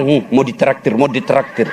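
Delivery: mock him as a freeloader; mocking villagers. People mocking policeman at café.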